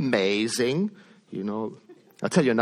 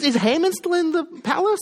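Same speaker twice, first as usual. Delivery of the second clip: much higher, eager question; king asking excitedly about Haman; 4 dB louder than usual. Retold or imitated. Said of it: "So he says".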